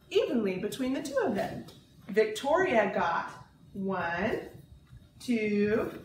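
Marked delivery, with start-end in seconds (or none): none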